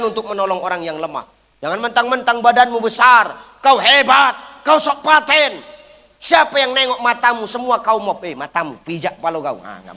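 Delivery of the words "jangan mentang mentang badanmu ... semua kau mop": preacher scolding a bully, shouting. Acted. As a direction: tell off the big show-off who thinks he's tough.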